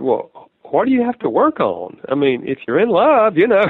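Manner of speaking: lightly mocking a dreamy bride-to-be; engaged lady in euphoria. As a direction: play the smitten fiancée who sees nothing to fix. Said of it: "she said".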